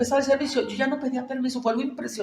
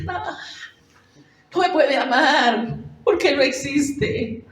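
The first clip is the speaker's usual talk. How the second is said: retold tearful protest; 5 semitones higher than usual. Girl at the campaign refusing God's love, tearful and raised. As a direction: plead that no one could love you, voice lifted and strained. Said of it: "se está llorando".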